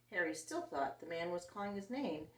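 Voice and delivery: ordinary delivery, nothing marked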